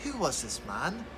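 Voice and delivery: expressive, rising and falling story voice; storytelling to children. A narrator's reading. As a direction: Bible story read aloud with feeling. Dramatic Bible story told to children.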